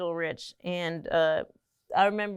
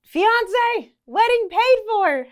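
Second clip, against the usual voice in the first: high, loud, gushing with joy; excited squeal; 9 dB louder, much slower than usual. Pretend. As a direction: squeal it out like big news. Imagined excited announcement.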